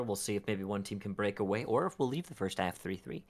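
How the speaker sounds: normal talking voice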